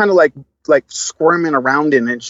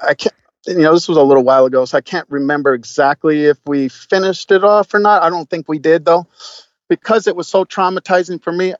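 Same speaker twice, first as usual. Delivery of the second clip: normal talking voice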